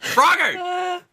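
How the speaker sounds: loud, high outburst; exasperated shout. Acted. Player freaking out at the unresponsive frog, exasperated shout. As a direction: shout the frog's name in alarm.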